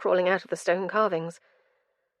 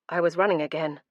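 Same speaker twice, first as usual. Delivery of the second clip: ordinary delivery, nothing marked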